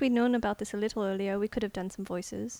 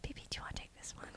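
whisper; 16 dB quieter, much faster than usual. Real, not acted. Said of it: "you just distracted her".

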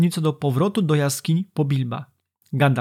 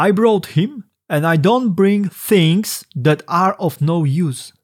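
louder, slow English quote; quoted original; 3 semitones higher, 5 dB louder, slower than usual. Shown as a demonstration. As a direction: read the original deliberately. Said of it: "w oryginale jest".

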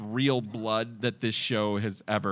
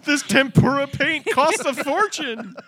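high, loud hammed-up protest; mock outrage; 13 semitones higher, 5 dB louder than usual. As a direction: wail it up high like a cheap producer. Mock complaint in character's voice.

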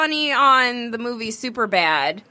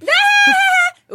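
loud, high squeal; piercingly high, delighted scream; excited scream; 7 dB louder than usual. Real, not acted. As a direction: shriek at a thrilling sight. Reacting to seeing the photo.

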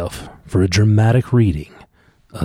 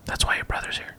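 whisper; 12 dB quieter than usual. Real, not acted. Whispering during recording.

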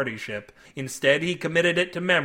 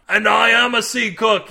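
loud, raised outraged voice; indignant yell; 6 semitones higher, 7 dB louder than usual. A narrator's reading. Older cook to the naked man, yelling. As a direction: yell as the insulted party. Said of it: "yelled an older fat man".

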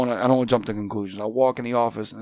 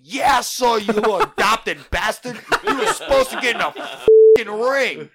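mock angry dad; 11 semitones higher, 8 dB louder than usual. Angry dad scolds daughter, laughing.

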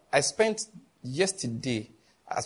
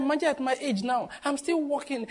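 mock weary aunt, higher and softer; imitating auntie; 9 semitones higher, 4 dB quieter than usual. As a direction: sigh it out like the tired auntie. Pastor voices aunt complaining.

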